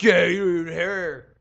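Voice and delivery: loud, slow macho boast; chest-pounding mode. Retold or imitated. Dude brags about body hair, loud slow chest-pounding boast. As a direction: drag it out loudly like a chest-pounder. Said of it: "pounding chest mode".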